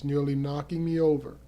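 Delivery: ordinary delivery, nothing marked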